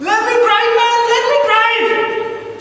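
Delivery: hammed-up yell. Climactic Roy/George shout.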